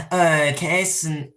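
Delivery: usual speaking voice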